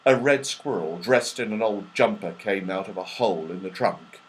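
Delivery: usual speaking voice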